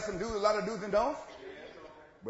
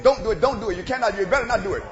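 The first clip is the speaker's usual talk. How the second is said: mock pleading nag; 4 semitones higher, 12 dB louder than usual. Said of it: "crying over your shoulder saying".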